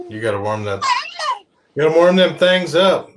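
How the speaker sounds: put-on hammy West Virginia accent. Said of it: "I did that in my West Virginia accent".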